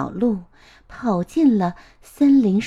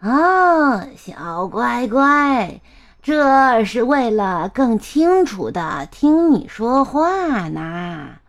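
drawn-out, coaxing fake granny; wolf posing as grandma; 3 dB louder, slower than usual. Wolf in bed explains big ears to the girl, coaxing. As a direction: coo it with long stretched vowels.

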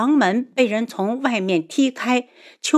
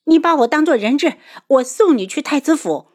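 high, loud, hurried instruction; urgent resolve; 7 semitones higher, 5 dB louder, faster than usual. A narrator's reading. Daughter offering herself as Yunchuan's hostage, urgent. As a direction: urge him quickly and firmly.

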